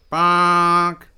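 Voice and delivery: loud, high, sustained honk; imitated car horn. A narrator's reading. Buffon honks at two pedestrians, blaring horn noise. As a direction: blare it out like a horn. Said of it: "honks the horn".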